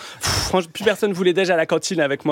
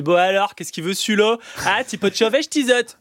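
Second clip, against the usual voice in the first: put-on higher-pitched northern French accent of an Arras waiter; put-on Arras patois, pitched up; 5 semitones higher than usual. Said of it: "qui débarque à Arras".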